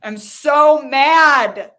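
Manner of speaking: loud, drawn-out, high-pitched anger; slow, forceful outburst of anger; angry release. Shown as a demonstration. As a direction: let the anger out, stretching the words. Let those emotions out.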